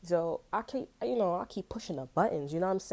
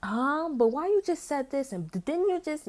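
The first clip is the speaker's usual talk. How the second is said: confused disbelief; 8 semitones higher, 3 dB louder than usual. Retold or imitated. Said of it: "in a mindset of".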